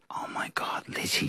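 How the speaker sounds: excited whisper, unvoiced; hushed whisper to Lizzie at the Oscars. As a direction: whisper it to Lizzie under your breath. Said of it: "I turned to Lizzie and I said".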